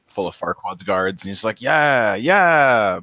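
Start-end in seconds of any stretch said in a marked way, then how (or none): 1.6-3.0 drawn-out, louder pumped-up cheer; swooping, stretched ring-entrance holler; Shrek. Retold or imitated. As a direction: imitate an ogre pumping up the crowd. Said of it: "like when Shrek goes into the".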